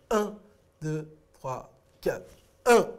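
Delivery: "un" punched, the other beats evenly spaced; stress on UN. Shown as a demonstration. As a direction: count with UN hit hard on the downbeat. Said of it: "elle démarre toujours là". (accent marqué sur « un »)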